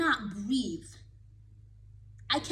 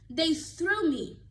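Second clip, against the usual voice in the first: they threw me, slow and louder, higher; slow emphatic; 5 semitones higher, 5 dB louder, much slower than usual. Real, not acted. Recalling how kids tossed her, slow loud disbelief.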